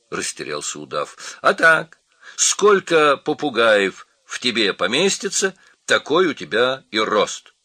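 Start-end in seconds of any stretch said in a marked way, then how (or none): 1.4-7.5 high, loud, confident parrot voice; loud cartoon parrot explaining; brisk parrot. A narrator's reading. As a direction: pipe it up high like a know-it-all parrot.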